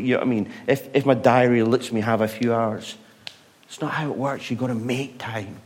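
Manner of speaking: usual speaking voice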